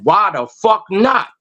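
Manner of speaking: normal talking voice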